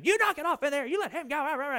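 lightly voiced mom telling the boys off; mild mock scold from the next room. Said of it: "she would say".

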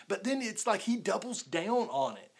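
ordinary delivery

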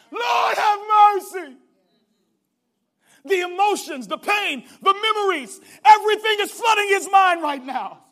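high, loud preaching cry; full-force preaching. Acted. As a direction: pour the scene out at full volume.

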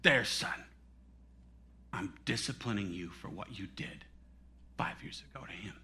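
very low, hushed voice, sinking to a whisper; gentle rebuke, almost whispered; Holy Spirit speaking. Retold or imitated. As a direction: speak softly as God to a son. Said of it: "the Holy Spirit spoke to me".

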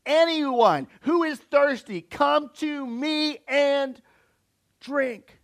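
slow, loud, drawn-out call; Jesus calling out to the crowd, slow and loud; loud proclamation. Retold or imitated. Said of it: "in a loud voice said".

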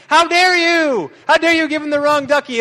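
hammed-up offended child's voice; mock outrage.

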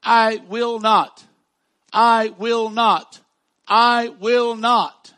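each "I will not" slow, loud, falling; emphasis, repeated. Shown as a demonstration. Pastor drilling the promise into the church, slow and loud. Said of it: "look at the emphasis here".

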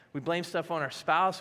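ordinary talk

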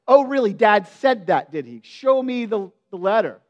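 loud mocking "oh really"; sarcastic disbelief. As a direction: scoff at the claim, then demand proof. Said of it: "my reaction is".

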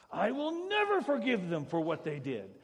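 loud, high, hard refusal with NEVER hit; shouting a grudge to the congregation; bitter refusal. Acted. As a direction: spit out the grudge. Voicing a bitter unforgiving person.

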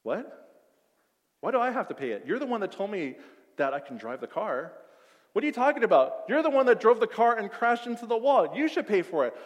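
mock indignant friend arguing over the bill; mock argument. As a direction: argue back, hammed-up. Arguing about crash bill.

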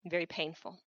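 ordinary talk, nothing marked

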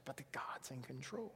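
soft, low near-whisper; hushed assurance. Real, not acted. Preacher reassuring the congregation about God's plan, whispered. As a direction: drop to a whisper to land it.

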